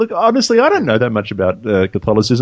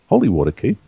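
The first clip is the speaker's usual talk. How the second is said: ordinary talk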